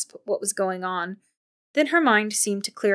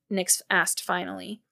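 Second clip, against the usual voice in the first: usual speaking voice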